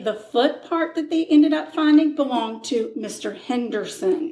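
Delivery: usual speaking voice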